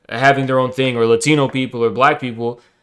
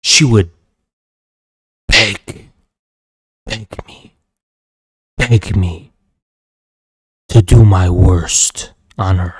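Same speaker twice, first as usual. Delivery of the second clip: menacing boast; 5 semitones lower than usual. Real, not acted. Boasting to dominate the troll.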